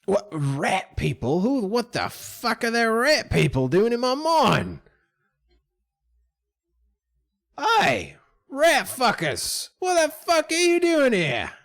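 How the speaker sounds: outraged, high mine boss; indignant yell, building in pitch; Don John. Acted. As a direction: holler at intruders in a high character voice. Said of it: "Don John will say".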